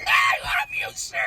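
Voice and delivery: high, loud mock gush of love; gushing thanks. Soldier blurting love to his general. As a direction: blurt it out with over-the-top joy. Hugging the general he'd cursed.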